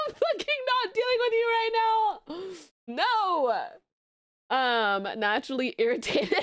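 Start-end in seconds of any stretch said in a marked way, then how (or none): 0.1-3.6 high, retold outburst; mock-furious, high-pitched blow-up; exasperated refusal. Streamer retelling her quarrel with Cap, exasperated.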